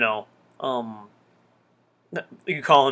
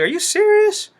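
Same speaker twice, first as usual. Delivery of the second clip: a much higher voice of shocked disbelief; incredulous; 4 dB louder than usual. Retold or imitated. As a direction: imitate Miles squeaking up in disbelief. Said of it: "He's like".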